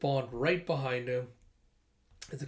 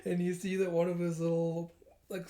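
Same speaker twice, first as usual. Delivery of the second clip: plain reading voice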